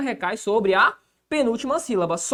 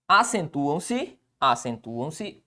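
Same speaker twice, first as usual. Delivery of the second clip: slow, spaced syllables, sinking lower; dictating; 5 semitones lower, 3 dB quieter, much slower than usual. Real, not acted. Teacher writing the next rule, slow dictation. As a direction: stretch the word out as you write it.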